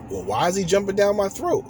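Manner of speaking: a hint of an indignant wife; imagined wife's complaint. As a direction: voice her imagined hurt. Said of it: "she's like".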